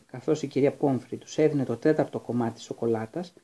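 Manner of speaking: plain reading voice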